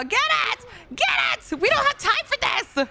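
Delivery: very high-pitched squealed orders; frantic shouting. Real, not acted. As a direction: screech urgently at your teammate. Urging teammate while scared.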